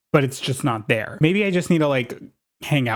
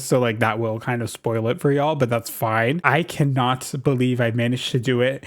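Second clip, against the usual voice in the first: ordinary talk, nothing marked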